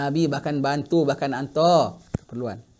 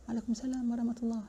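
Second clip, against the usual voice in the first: hushed, much softer reply to the greeting; a faint, barely audible return of the salam; 6 semitones higher, 12 dB quieter than usual. Acted. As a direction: answer so quietly he can barely hear. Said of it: "Eh, pelan aja".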